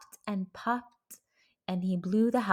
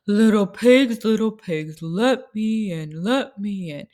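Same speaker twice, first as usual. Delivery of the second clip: big bad wolf calling; 7 dB louder than usual. Wolf knocks at door.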